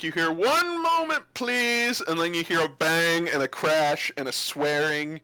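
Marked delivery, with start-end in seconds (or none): 0.3-2.0 high, strained call from another room; raised shopkeeper's holler; calling from the back. Acted. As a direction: call out to waiting customers, high-pitched. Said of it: "you hear from the back".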